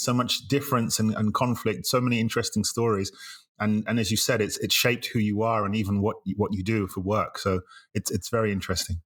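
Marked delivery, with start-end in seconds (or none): none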